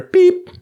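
high, loud beep; imitated machine beep. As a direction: squeak it out like an answering machine tone.

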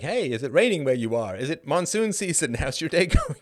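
lightly imitated Indian accent with a laugh; a hammed-up, friendly Indian accent; mock Indian accent. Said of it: "some thick Pajit accent".

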